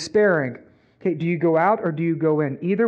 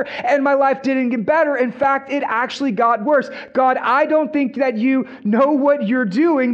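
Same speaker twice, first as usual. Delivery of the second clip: higher-pitched, insistent acted grievance; angry at God; 6 semitones higher, 3 dB louder than usual. Disappointed believer telling God he'd do better, acted angry. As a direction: argue your case indignantly to God. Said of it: "then we become angry at God".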